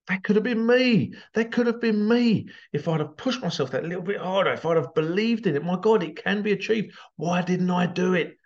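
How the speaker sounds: high, anguished regret; regretful cry. Retold or imitated. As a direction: wail at the missed chance. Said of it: "may have thought".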